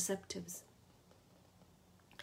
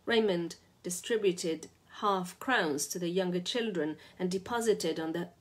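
plain reading voice

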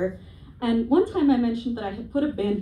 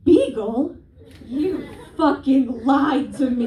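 high, loud mock betrayal at the letter; mock outrage; 4 semitones higher, 6 dB louder, much slower than usual. Comic opening the DNA result, mock betrayed outrage. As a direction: feign shock, then slow accusing. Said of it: "Open it up and be like".

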